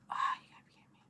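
hushed, voiceless complaint; exasperated whisper. Real, not acted. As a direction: whisper it under your breath in frustration. Frustrated after a failed jump.

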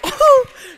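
loud, high laugh; high-pitched whoop of laughter. Real, not acted. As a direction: burst out laughing in disbelief. Laughs at what happened.

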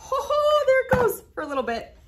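sudden high, loud oh of delight; delighted surprise. Real, not acted. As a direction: yelp as the can finally sticks. Magnet suddenly sticks to can.

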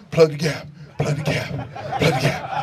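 low, breathy repeated muttering; hushed, anxious chant; nervous self-reminder. Retold or imitated. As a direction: mutter it to yourself like a nervous rookie. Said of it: "So I'm like".

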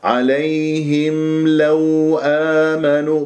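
slow chant easing through عليهم and لو; drawn-out, fluid tajweed recitation; soft, smooth glides. Shown as a demonstration. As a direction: let the "ay" of عليهم and "aw" of لو flow. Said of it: "لازم أنا أجيب الليونة والسلاسل".

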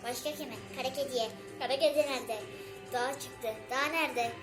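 squeaky chipmunk nursery rhyme; helium voice. Real, not acted. Other kid recites on helium.

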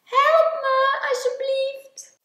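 high, loud plea; =wail for rescue; crying out for help. A narrator's reading. Klein stuck in the river calling Groot, crying out. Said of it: "riep Klein".

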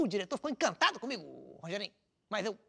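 normal talking voice

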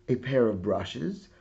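plain reading voice

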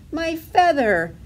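high, loud, drawn-out wail; gasped alarm. A narrator's reading. Duckling watching her feather fly away, alarmed. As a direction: wail it out slowly, pitched high. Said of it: "gasped Dilly".

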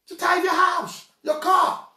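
preaching shout. Real, not acted. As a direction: call it out to the whole church. Preacher exhorting congregation.

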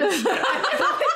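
high, slow laughing words; giggling through the story; laughing while telling. Real, not acted.

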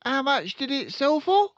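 put-on high, loud boyish reply; eager son. Son answering his father, high and keen.

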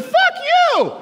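high, piercing yell; kid's furious yell. Retold or imitated. Boy yelling at friend's dad, high-pitched shout. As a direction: scream it back at the dad. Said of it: "And I said".

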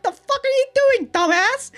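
high, loud mock yell. Voicing Yoshino yelling at him.

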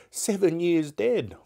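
high-pitched wondering; musing Scrooge. Acted. Scrooge puzzling over the ghost's travels, musing. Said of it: "mused Scrooge".

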